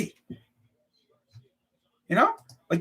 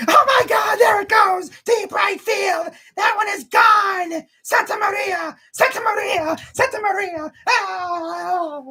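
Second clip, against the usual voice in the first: very high, strained screaming play-by-play; absolute ballistic; 12 semitones higher, slower than usual. Acted. Fan imitating commentator over every little thing, absolutely ballistic. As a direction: lose it completely over a home run. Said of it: "going absolute ballistic over every little thing".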